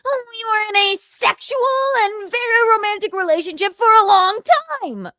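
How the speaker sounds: high, loud put-on voice; high mock impression. Doing another impression for the chat, high and loud. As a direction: pitch it way up and gush. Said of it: "any other impressions?".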